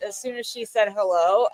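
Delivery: ordinary talk, nothing marked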